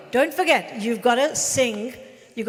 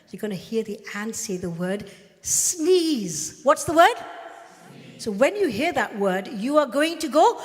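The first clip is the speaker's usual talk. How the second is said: ordinary talk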